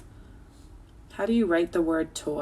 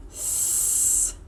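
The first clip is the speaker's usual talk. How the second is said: whisper; 6 dB quieter than usual. Shown as a demonstration. Teacher whispers spelling.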